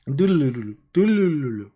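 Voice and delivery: rippling, falling "doodaloo" trill; Wayne's World flashback noise. Retold or imitated. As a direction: imitate a wobbly going-back-in-time sound effect. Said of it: "Wayne's World".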